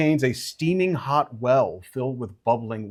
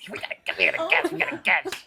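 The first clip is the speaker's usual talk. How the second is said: tiny creature voice, higher and rapid; little creature; 6 semitones higher, 3 dB quieter, faster than usual. Acted. Little steam creature echoing the others, quick and higher. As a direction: chatter it fast like a small critter.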